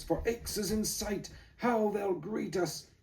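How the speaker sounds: high, gasping, slowing cry; exhausted rider seeing Aix; breathless joy. Acted. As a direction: gasp with relief as the town appears. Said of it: "gasped Joris".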